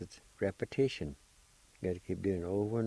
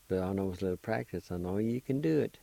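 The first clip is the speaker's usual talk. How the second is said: ordinary talk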